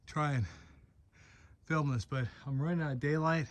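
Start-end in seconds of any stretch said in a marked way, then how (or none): none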